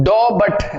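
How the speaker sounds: डॉ बट slowly broken into DAW and BUT; split misreading. Shown as a demonstration. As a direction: read doubt slowly as two pieces, डॉ बट. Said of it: "इसको डॉ बोलता है". (डॉ बट, धीरे-धीरे तोड़कर गलत उच्चारण)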